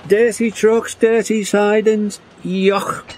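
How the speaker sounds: very high-pitched, fussy disgust; high squeal of distaste; disgusted engine. A narrator's reading. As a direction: complain in a high, squeamish character voice. James joins engines' complaints in story.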